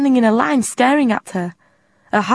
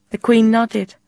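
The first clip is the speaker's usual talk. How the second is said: ordinary delivery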